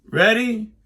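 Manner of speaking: high, loud mock shout from son; son shouting back. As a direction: yell the one word back over the noise. Son mishears over chainsaw.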